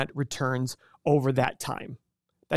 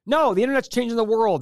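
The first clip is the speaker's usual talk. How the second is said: past excited self; 6 semitones higher, 3 dB louder than usual. Retold or imitated. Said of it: "I thought that way".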